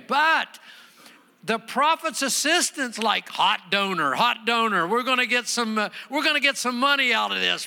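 high, loud, driving sermon delivery; full-force preaching. Real, not acted. Preacher telling congregation of Naaman and the prophet, full-force preaching. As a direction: preach it hard, pitched well up.

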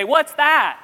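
loud, high-pitched yell; loud child. Retold or imitated. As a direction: blurt it out at full volume, imitating a loud kid. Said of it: "and wonderful and loud".

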